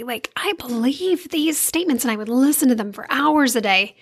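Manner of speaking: ordinary delivery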